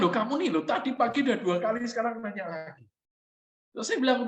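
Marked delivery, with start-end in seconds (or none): 0.0-2.8 put-on high, half-amused scolding of a child; mock exasperation at a repeat question; lightly raised, teasing complaint to his son; exasperated dad.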